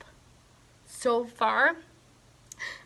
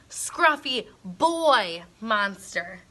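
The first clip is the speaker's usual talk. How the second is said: ordinary delivery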